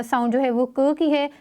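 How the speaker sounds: ordinary delivery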